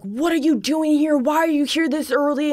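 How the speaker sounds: retold cop freaking out. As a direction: mimic an alarmed cop demanding answers. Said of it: "they start freaking out on me".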